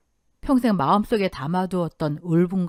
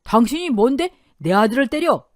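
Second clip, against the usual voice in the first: loud, higher shout at the uncle; 5 semitones higher, 6 dB louder than usual. A narrator's reading. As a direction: yell it out in defense of your son. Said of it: "큰 소리로 외치며". (사위를 때린 큰아버지에게 외치는 장인, 고함)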